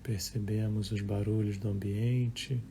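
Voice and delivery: calm, measured meditation voice with long pauses; guided meditation. Acted. Leads a live guided meditation. (voz calma de meditação guiada)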